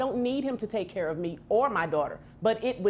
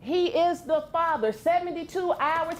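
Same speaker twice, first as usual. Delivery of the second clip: high heated insistence; shouting; 7 semitones higher than usual. Real, not acted. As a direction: shout that he is the father. Shouting gets out of control.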